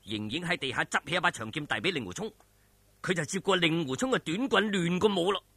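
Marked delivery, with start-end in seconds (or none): none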